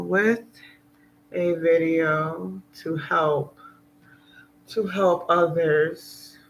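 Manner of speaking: drawn-out words with long pauses; slow, halting message. Real, not acted. Vlogger asking viewers for questions to help others, slow and halting. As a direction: stretch each phrase, waiting before the next.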